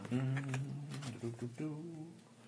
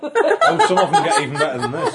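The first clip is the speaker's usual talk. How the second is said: high, loud laughing voice; laughing loudly; 9 dB louder than usual. Real, not acted. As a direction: crack up while saying it. Laughing at the scene.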